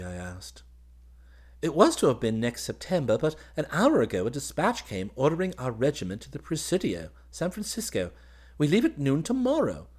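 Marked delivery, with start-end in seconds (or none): 1.6-9.9 high, animated officer's voice; happy young officer. Acted. Officer telling his cousin of the regiment's orders, excited. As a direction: pour out the news eagerly.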